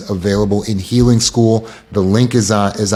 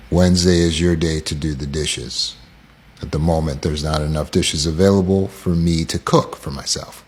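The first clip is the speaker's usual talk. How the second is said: low, quiet, emotionless statement; deadpan, pitched down, with measured pauses; zero emotion; 6 semitones lower, 4 dB quieter than usual. Shown as a demonstration. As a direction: state the bare facts without feeling. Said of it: "Zero emotion".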